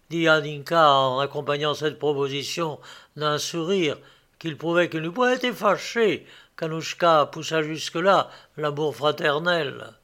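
usual speaking voice

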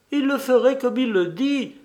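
high, lightly tearful female character voice; woman's exclamation. A narrator's reading. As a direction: cry it out as the high-voiced wife. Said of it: "s'écria madame Hodges en essuyant ses yeux".

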